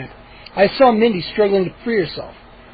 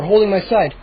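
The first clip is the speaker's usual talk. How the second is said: usual speaking voice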